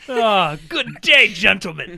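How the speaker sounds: loud, slow gentlemanly greeting; mock British gentleman. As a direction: draw it out like a hammed-up English gent. Playing a British gentleman, monocle joke.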